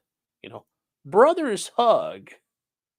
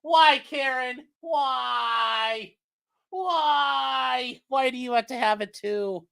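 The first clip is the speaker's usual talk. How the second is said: exasperated wail; 9 semitones higher, much slower than usual. Acted. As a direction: wail it at the screen in mock despair. Exasperated outcry at news clip.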